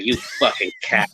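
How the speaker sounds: ordinary talk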